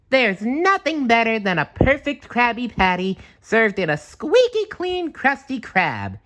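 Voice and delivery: high, loud, bright cartoon sponge voice; proud declaration in a squeaky high voice; SpongeBob voice. A narrator's reading. SpongeBob praising his job, high squeaky proud voice. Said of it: "he declared".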